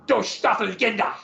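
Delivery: lightly put-on German accent, "Du Staffelkinder" said in a clipped German way; mock German. Shown as a demonstration. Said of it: "you gotta say it like that".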